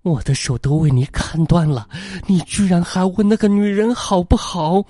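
jealous wife shrieks at her husband over the other woman; hysterical outburst. A narrator's reading. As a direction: scream it, losing control. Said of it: "歇斯底里地说".

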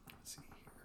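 thinking aloud under the breath; whispered aside. Real, not acted. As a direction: whisper it to yourself. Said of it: "muttered aside".

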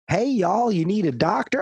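put-on high Southern-accented receptionist; Southern Aunt B. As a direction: greet like Aunt Bee from Mayberry. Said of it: "Aunt B from Mayberry".

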